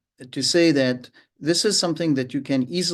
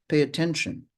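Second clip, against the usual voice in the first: ordinary talk, nothing marked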